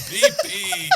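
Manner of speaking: high squeal through laughter; laughing. Pretend. Laughing repeat of the joke.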